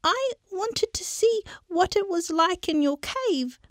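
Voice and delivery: high squeaky begging, nearly crying; scared mouse begging to be freed; pleading mouse. Acted. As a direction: plead with the lion in a tiny voice. Mouse character voice.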